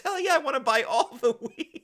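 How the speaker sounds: high, loud and laughing; mock-hyped cheer through a laugh; eager stoner. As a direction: blurt it out, thrilled to buy. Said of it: "He goes".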